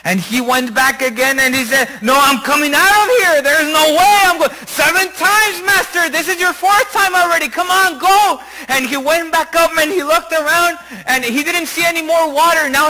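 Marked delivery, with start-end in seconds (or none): 2.0-4.5 higher, raised-voice protest; protesting refusal. Acted. Master refusing another dip in the river, loudly protesting. 4.7-8.4 high, insistent coaxing; urging servant. Acted. Servant urging his master back under, high and insistent. Servant urges the fourth dip.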